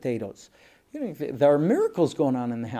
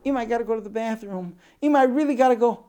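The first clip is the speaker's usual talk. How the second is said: put-on high, fast child's plea, getting louder; urgent little girl; 8 semitones higher, 5 dB louder, faster than usual. Older sister desperate for the bathroom, urgently pleading. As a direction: beg mother in a rushed, rising kid voice. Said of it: "The sister comes in and says".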